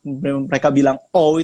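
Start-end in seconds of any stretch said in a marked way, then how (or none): 1.1-1.4 British "oh", drawn out as a gliding vowel; British accent. Shown as a demonstration. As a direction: say "oh" long and loud in a British accent. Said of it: "di British accent".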